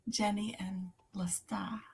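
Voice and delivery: whispered Boston accent; whispered Boston puzzling. Real, not acted. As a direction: breathe the line in a Boston whisper. Whispering a chat line aloud.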